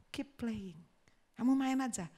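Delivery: soft, quiet coaxing; gentle encouragement. Retold or imitated. Pianist sitting by the child, quietly reassuring. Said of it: "dia bilang sama anak kecil".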